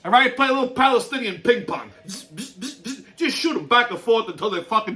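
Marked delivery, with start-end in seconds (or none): 2.1-3.0 short, soft buzzing bursts; quick buzzes for spraying fire hoses; imitated hose blasts. Shown as a demonstration.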